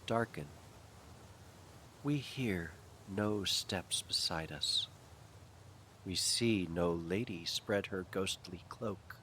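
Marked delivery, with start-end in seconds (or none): none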